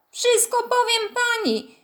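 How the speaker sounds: high, slow tearful whine; sniffling whimper. A narrator's reading. Kostek hidden behind coats, threatening through sobs. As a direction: whimper it like a hurt little tattletale. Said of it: "chlipnęła nagle kurtka".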